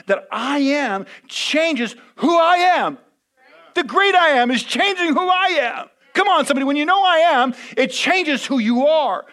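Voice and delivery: high, loud, slowed preaching climax; full-force preaching. Acted. Pastor rousing the congregation, full-force preaching. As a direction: lift the congregation with it.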